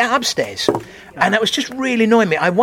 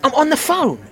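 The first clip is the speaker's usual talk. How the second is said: imitated indignation; 7 semitones higher than usual. Woman indignantly answers from audience.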